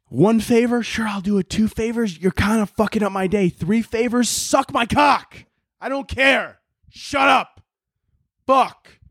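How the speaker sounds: rising rant that tops out in high yells; screaming at people. Real, not acted. Comic fed up with favor requests, screaming in exasperation. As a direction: build from irritated to shouting. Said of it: "instead of just screaming at people".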